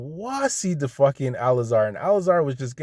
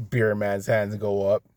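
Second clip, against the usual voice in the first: ordinary delivery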